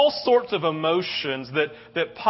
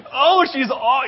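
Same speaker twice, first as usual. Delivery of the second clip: high, louder gush of tender awe; 7 semitones higher, 6 dB louder, slower than usual. Retold or imitated. New father gushing over his newborn daughter, high tender awe. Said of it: "I'm like".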